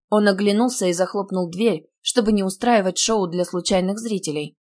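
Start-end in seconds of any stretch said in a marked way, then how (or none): none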